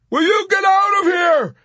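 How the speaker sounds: high, loud pleading cry; beseeching yelp. A narrator's reading. Yossarian shoving Arfi away, beseeching yelp. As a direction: yelp it as a desperate plea. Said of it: "he yelped beseechingly".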